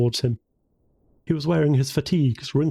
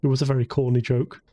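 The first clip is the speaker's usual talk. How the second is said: plain reading voice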